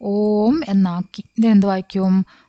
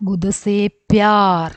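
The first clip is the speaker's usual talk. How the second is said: slow reading aloud; slower than usual. Shown as a demonstration. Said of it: "reading the poem line slowly".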